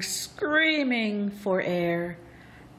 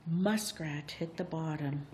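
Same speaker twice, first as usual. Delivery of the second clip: ordinary delivery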